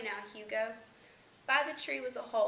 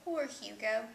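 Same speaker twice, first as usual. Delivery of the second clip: usual speaking voice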